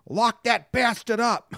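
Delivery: put-on high, slow "Lock that bastard up" in a thick regional accent; mom's thick accent. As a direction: mimic mom's heavy accent, drawing out the line. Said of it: "She says bastard in a real thick accent".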